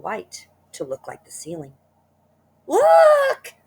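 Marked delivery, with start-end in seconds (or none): 2.7-3.5 very high, very loud shriek; scream. Acted. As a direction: shriek at the sight. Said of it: "screamed Missus Twit".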